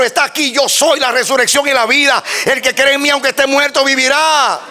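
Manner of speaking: high, strained pulpit shout; impassioned high-pitched sermon delivery; full-force preaching. Real, not acted. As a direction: cry out each phrase with mounting fervour.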